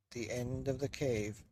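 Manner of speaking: ordinary delivery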